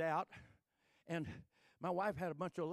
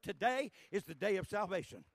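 louder, insistent; 7 dB louder than usual. Shown as a demonstration. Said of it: "that's a true statement".

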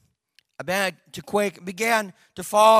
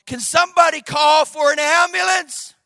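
urgent raised cry, higher and louder; calling out for help; 6 semitones higher, 5 dB louder than usual. Retold or imitated. Wife calling out for an ambulance, urgent raised call. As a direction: call out to anyone who can help. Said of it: "My wife called".

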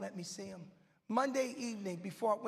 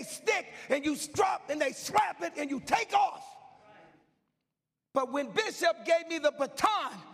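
higher and louder, shouted climax; full-force preaching; 6 semitones higher, 6 dB louder than usual. Acted. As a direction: hammer it home to the church.

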